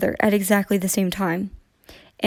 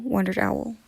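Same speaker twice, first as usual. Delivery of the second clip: usual speaking voice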